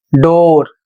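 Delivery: डोर said very slowly, hard retroflex ड at the start; slow model word. Shown as a demonstration. Teacher modelling डोर slowly for Hindi-speaking pupils. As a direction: draw out डोर with a clear ड sound. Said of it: "डी का उच्चारण हो जाएगा ड".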